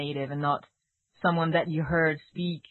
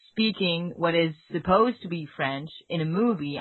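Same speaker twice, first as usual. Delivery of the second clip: normal talking voice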